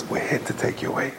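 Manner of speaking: hushed whisper from the hole in the floor; a whispered promise to free the prisoner; secret whisper. Retold or imitated. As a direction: breathe it like a rescuer who must not be heard. Rescuer pops up in tunnel.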